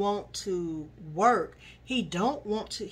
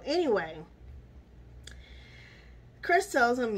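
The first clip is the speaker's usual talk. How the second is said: normal talking voice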